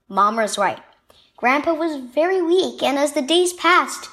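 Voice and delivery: plain reading voice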